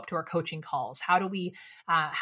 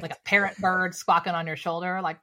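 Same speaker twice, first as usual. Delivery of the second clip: ordinary talk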